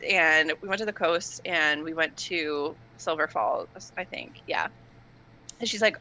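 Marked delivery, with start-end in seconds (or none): none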